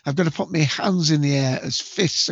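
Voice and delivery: usual speaking voice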